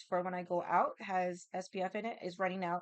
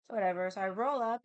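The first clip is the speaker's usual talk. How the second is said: normal talking voice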